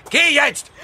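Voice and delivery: shouted order, high; sharp command. Acted. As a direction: bark it as a command. Husband orders wife away.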